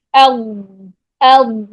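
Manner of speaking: اد held long, tongue sides pushed back; correct heavy ضاد. Shown as a demonstration. Teacher models for students, correct heavy ضاد. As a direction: hold اد heavy, sound thrown back to the throat. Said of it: "آپ کی زبان پیچھے".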